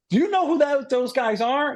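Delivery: high-pitched retold amazement; amazed question. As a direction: imitate someone impressed and incredulous. Mechanic's amazed reaction, retold.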